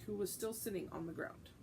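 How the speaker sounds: ordinary delivery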